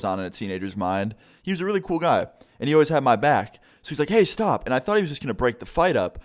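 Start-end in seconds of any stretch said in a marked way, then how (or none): 4.1-4.7 much higher, contained shout; imitated teacher's call. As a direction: call out sharply to halt a fight. Teacher calls out to stop fight.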